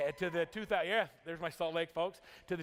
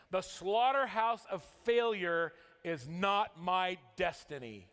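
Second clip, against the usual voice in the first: dramatic recitation; 3 semitones higher than usual. Acted. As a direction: proclaim each line like a vow. Reciting Og Mandino's creed.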